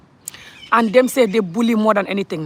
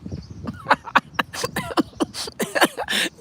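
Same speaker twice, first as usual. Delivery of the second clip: high, squealing laughing fit; real laughter close to crying; 4 dB quieter than usual. Real, not acted. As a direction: laugh helplessly till it sounds like crying. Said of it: "I'm laughing, but I'm crying".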